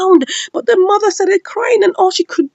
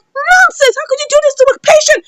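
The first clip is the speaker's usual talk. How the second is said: very high indignant outcry; outraged scolding; 12 semitones higher than usual. Acted. Hospital staff to the man who hit a patient, outraged scolding. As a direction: act out shocked staff, pitched way up.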